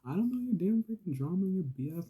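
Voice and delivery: high, loud indignant rant; shrill-pitched venting at people who won't stop; exasperated outburst. Acted. Inmate telling off noisy cellmates, exasperated.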